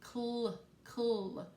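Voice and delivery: Cl isolated, said slowly and crisply; teacher modelling the blend Cl for kids; sounding out the blend. Shown as a demonstration. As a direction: say the blend Cl on its own, twice. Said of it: "I hear another blend".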